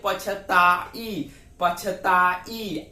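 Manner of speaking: पछताई drawn out very slowly, the आई lengthened; teacher sounding out पछताई to isolate आई; slow syllable demo. Shown as a demonstration. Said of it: "आप अपने से उच्चारण कीजिए".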